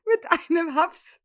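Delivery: slow incredulous repeat; slow mock echo. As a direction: echo it slowly in disbelief. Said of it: "Was hast du gesagt?".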